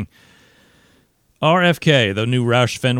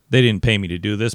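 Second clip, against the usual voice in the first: ordinary delivery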